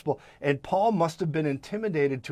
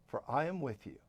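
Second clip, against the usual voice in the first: much softer, intimate; soft repeat; 3 semitones lower, 13 dB quieter than usual. Acted. Preacher repeating God's promise one more time, softly. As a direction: lean in and say it softly. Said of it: "one more time".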